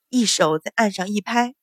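usual speaking voice

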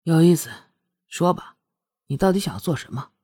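low voice, a touch amused; lightly amused. A narrator's reading. Prince asks what she really wants, lightly amused. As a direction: ask low with mild amusement. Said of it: "轻轻一笑".

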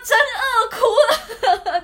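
high, loud laugh through tears at her own story; laughing while crying. Real, not acted. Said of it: "就是饿哭了". (边笑边带哭腔)

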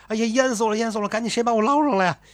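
high, fast panicked cries; frantic shouting. A narrator's reading. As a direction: gasp it out like a drowning man calling for rescue. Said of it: "还那儿叫". (又高又快地慌张呼救)

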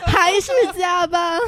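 slow, loud, high mock grumble; mock complaint shout. Real, not acted. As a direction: drag it out in a loud moan.

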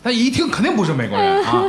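delivering the punchline amused; real laughter in the voice; laughing. Real, not acted. Joke about Quebec accent.

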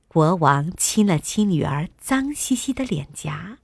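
ordinary delivery

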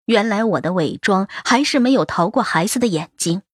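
ordinary delivery, nothing marked

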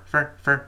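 "分儿" said short and soft, the weak form of for. Shown as a demonstration. Teacher showing how for is reduced, soft. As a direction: swallow "for" into a quick light syllable. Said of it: "那个for读快门的分儿".

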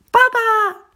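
small daughter screaming for her father; little girl's cry. Acted. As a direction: squeal for daddy in terror.

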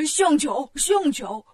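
loud, gleeful repeated shout; delighted clapping cheer. A narrator's reading. Pigsy claps and cheers at the bridge trick, loud and gleeful. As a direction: cheer it like applauding a show. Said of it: "八戒鼓掌说".

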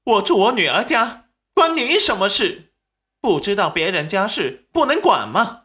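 high, loud defiant protest; indignant retort. A narrator's reading. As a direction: argue back shrilly at an outsider meddling. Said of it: "韩秀秀也不干了".

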